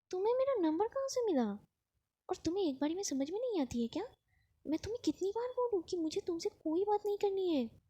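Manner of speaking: high, sharp angry outburst, pitched well up; furious at the caller, voice climbing high. A narrator's reading. Avni furious at Shanaya's call, high angry outburst. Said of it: "Avani गुस्से में कहती है".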